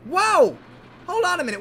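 high, loud cry of surprise; startled. Acted. Engine suddenly speeding, high startled cry. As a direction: yelp in astonishment.

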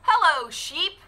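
high, bright greeting as the duck; cheerful duck. Acted. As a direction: call out hello as a friendly duck. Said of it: "said Duck".

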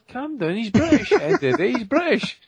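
high mock calming voice; lightly voiced wife calming him down; mimicking his wife. Wife telling him to calm down, in a high mock voice. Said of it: "he's like".

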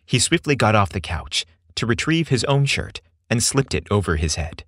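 plain reading voice

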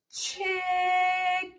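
CHICKEN drawn out, almost sung; stretched word. Shown as a demonstration. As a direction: hold both vowels of CHICKEN on a level note. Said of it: "Ch, ch".

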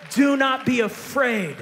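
loud, slow, ringing cry of do not be afraid; preached shout. Acted. Pastor crying out the angel's words to the church, loud and slow. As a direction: throw it out to the whole church.

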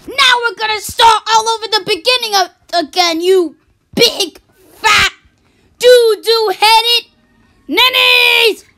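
rant, slowing on insults; high shouting tantrum; furious rant. Acted.

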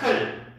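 클 as K closed with L; blended CL. Shown as a demonstration. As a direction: put L under 크 to make 클. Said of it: "L은 밑에 받침으로". (L을 받침으로 붙인 '클' 발음)

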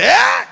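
high, loud outburst; short explosive mock yell; sudden yell. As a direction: blurt out a high-pitched cry. Acting out a sudden slip-up.

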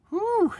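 high loud release of breath; loud whew. Real, not acted. Vlogger letting off steam after ranting, loud whew. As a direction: blow it all out.

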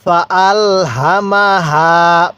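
each syllable drawn out slowly for learners; slow recitation demo. Shown as a demonstration. Teacher slowly models ফা আল হামা হা for students to repeat. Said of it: "আমার সাথে সাথে পড়বেন".

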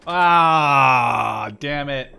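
loud angry shout at dying; sudden loud curse of a killed player; frustrated shout. Real, not acted. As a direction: yell it out in frustration. Killed again in game.

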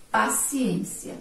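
paciência read slowly, syllable by syllable; slow model reading. Shown as a demonstration. Teacher to pupils, reading paciência slowly. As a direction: draw out paciência for the class. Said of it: "vamos fazer a leitura".

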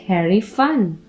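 ordinary delivery

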